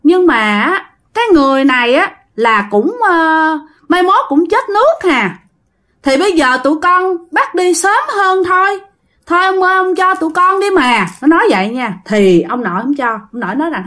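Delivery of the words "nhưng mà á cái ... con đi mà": put-on high, wheedling ghost-child voice; little child. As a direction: plead with grandpa like a small child. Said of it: "giọng của một đứa con nít". (giọng con nít nài nỉ ông, cao)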